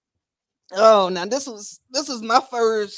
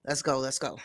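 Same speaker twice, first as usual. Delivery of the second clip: ordinary talk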